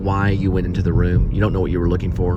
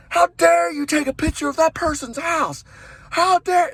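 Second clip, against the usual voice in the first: very high put-on indignant voice; outraged scolder. Onlooker scolding an insurance agent taking photos, outraged. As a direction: scold in a high, scandalised pitch.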